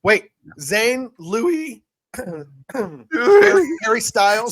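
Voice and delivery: high, loud excited squeal of names; excited fangirling. Real, not acted. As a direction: gush over the band members. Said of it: "Calm, calm down".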